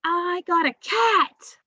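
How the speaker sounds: slow, high and loud with delight; teacher acting out pure excitement; excited exclamation. Shown as a demonstration. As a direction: show the thrill of big news. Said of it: "sentences that are exciting".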